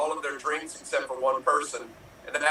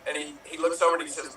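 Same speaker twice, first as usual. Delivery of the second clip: ordinary delivery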